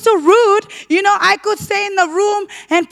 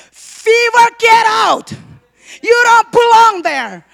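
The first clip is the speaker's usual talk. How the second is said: loud, higher shout ordering the fever out; commanding rebuke; 5 semitones higher, 5 dB louder, slower than usual. Retold or imitated. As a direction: cast it out with full authority.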